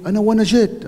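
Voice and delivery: re-enacted calling out; retold shout in Arabic. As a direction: imitate a man shouting from inside. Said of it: "אז הוא צועק לי".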